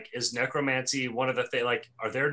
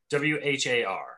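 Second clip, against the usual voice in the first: ordinary talk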